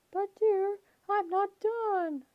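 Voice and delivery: high, pleading falsetto. A narrator's reading. Grover's falsetto.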